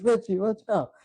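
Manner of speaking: high-pitched imitated cry of alarm; mock alarmed warning. Imitating actress warning Richie, mock alarm. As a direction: call it out like a panicky film heroine, lightly.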